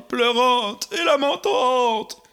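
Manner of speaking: ordinary delivery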